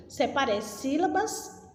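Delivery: usual speaking voice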